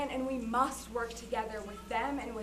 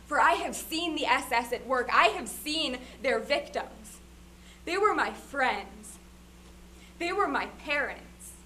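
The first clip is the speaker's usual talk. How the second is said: louder, higher and anguished; loud, grieving testimony before the President; impassioned testimony; 3 semitones higher, 6 dB louder than usual. Acted. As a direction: declare it like a witness who was there. Witness testimony rising in force.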